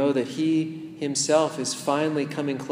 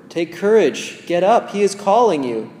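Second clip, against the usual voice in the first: higher, louder call across the crowd; calling over; 5 semitones higher, 8 dB louder than usual. Pretend. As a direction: shout encouragement to the beggar. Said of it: "calling you over".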